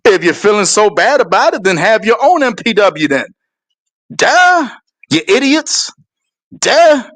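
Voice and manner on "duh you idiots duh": mocking duh. Real, not acted. Mocking the critics as idiots.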